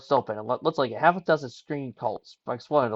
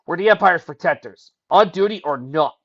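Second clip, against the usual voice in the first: firm call to action; 4 semitones higher, 7 dB louder than usual. Acted. Sawyer rallying.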